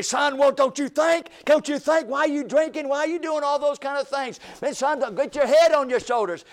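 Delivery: loud, pleading dad lecturing his son; scolding father. Retold or imitated. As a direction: raise your voice like a preaching father.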